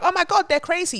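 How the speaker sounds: high-pitched mock shock; mock panic. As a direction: squeal it in fake horror. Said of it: "And you're like".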